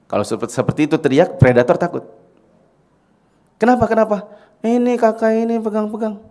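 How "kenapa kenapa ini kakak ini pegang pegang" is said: put-on high-pitched child complaint; raised, insistent tattling voice; child reporting touching. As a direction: act a kid telling on someone. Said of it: "Harus kayak gitu".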